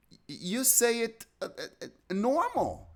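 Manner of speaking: lightly voiced foreign woman, hesitant; a hint of Olga's halting English; imitating Olga. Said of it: "she said".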